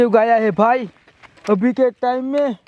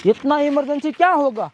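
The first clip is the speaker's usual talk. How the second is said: ordinary talk, nothing marked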